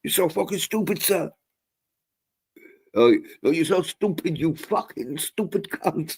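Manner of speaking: high, sneering insults with a fake-polite sir; scammer insulting. Defeated scammer cursing the old man, high sneering abuse. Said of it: "he's like".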